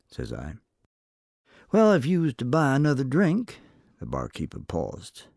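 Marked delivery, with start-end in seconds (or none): 1.7-3.6 lightly voiced old barkeep, higher and louder; a sly saloon barkeep angling for a drink. A narrator's reading. Barkeep bargaining with the cowboy for gossip, sly.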